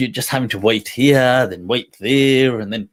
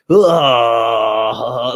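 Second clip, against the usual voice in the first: long, loud pent-up "aah" of frustration; frustrated groan; 3 semitones lower, 5 dB louder than usual. Retold or imitated. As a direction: let the frustration burst out in one drawn-out groan. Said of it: "all these curses in my heart".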